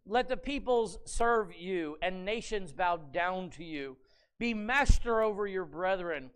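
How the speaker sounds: measured, solemn reading of the blessing; scripture reading. Acted. Preacher reading Isaac's blessing to the congregation, solemn.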